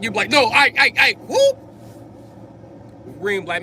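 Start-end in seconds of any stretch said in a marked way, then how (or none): 0.3-1.6 high, loud flustered stammer; panicked high stammer. Retold or imitated. Caught lying to the Grim Reaper, squeaking and stammering. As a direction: trip over the words in a squeaky panic.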